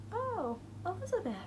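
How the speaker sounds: high mock-sweet teasing voice; lightly voiced teasing kid from the back of the bus; teasing classmate. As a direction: needle her like a smug bus-mate. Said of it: "someone from the back of the bus was like".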